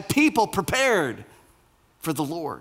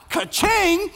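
excited ka-ching; 13 semitones higher than usual. Pretend. Angel's excited reaction.

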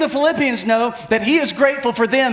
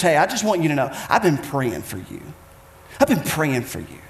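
sincere; 7 semitones lower, 3 dB quieter than usual. Retold or imitated. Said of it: "someone walks up to you and says".

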